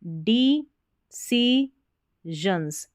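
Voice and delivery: डिसीजंस stretched out syllable by syllable; डिसीजंस spelled out very slowly, with j sound; very slow model reading. Shown as a demonstration. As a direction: drag out डिसीजंस slowly to show each sound. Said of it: "डिसीजंस नहीं पढ़ना है".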